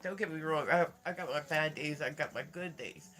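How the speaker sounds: ordinary talk, nothing marked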